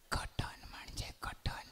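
breathy secret whisper, slow; whispered girlfriend voice. Acted. Girlfriend whispering to her lover at night, slow whisper. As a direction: whisper like a girlfriend hiding under the sheet.

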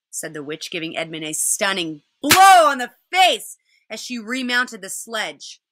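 ordinary delivery

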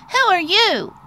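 high-pitched little mouse voice; high, eager mouse question; crying out as Little Mouse. Acted. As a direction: call it out as a curious tiny mouse. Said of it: "cried Little Mouse".

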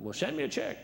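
high voice of a dry wife; wife's voice. Retold or imitated. Wife telling him to send money, high put-on voice. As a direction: pitch it up, deadpan wife.

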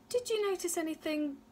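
anxious question, starting high; high, eager mother's question; asked quickly. A narrator's reading. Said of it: "asked Ma quickly".

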